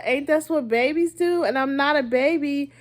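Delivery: normal talking voice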